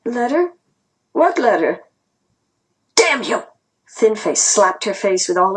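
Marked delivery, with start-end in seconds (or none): 0.0-1.8 higher, hesitant questioning; a small, timid feigned puzzlement; timid reply. A narrator's reading. Grandma answering her interrogator, timidly. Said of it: "replied timidly". 3.0-3.4 high, sharp outburst of anger; angry curse. A narrator's reading. Thin Face to Grandma before slapping her, angrily.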